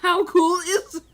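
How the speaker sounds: loud question through laughter; laughing. Real, not acted. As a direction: laugh the words out. Laughing at player.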